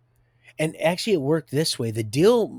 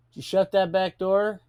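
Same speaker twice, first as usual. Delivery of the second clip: calling out; 8 semitones higher, 6 dB louder than usual. Real, not acted. Calls to someone in another room.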